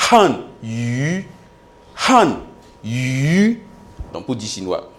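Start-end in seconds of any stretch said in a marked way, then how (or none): 0.1-3.6 "Han", a pause, then a long "Yu"; modelled pronunciation. Shown as a demonstration. Teacher has the class repeat Chinese words, slow modelled pronunciation. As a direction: say "Han Yu" syllable by syllable, drawn out. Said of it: "Répétez après moi".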